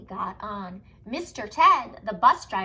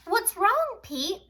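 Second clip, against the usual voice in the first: high Callie voice; 9 semitones higher, 4 dB louder, slower than usual. Acted.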